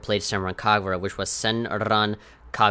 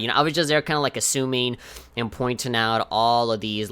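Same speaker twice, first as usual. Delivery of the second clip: ordinary talk, nothing marked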